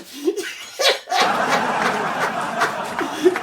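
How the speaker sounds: long high laughing howl. Real, not acted. Laughs at final punchline.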